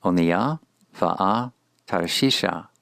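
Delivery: slow "Onia va'a Tarshisha", paused between words. Shown as a demonstration. Teacher introduces the Hebrew title to learners, slow and word by word. Phrase read in parts, then whole.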